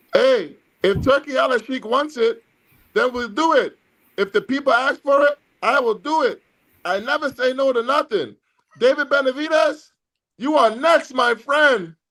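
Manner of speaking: high-pitched victorious boxer in an interview; Canelo Alvarez impression. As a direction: play the winning fighter, voice pushed right up. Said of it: "take you into the post-fight interview".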